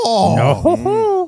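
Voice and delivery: high, loud laughing yo; excited shout. Acted. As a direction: echo the hype, laughing. Cohost echoes hyped greeting.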